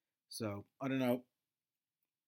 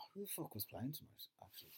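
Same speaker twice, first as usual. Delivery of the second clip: unvoiced whisper to self; whispered question; 13 dB quieter than usual. Real, not acted. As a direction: mutter it under your breath. Asking self under breath.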